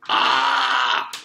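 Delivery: short, loud cry of pain; pained scream. A narrator's reading. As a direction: yelp as the tool hits the tooth. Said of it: "Jason screamed in agony".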